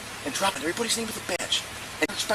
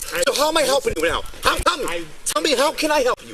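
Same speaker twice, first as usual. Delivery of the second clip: loud, forceful real dressing-down; angry scolding; 3 dB louder than usual. Real, not acted. Officer to man talking over him, angry shouting.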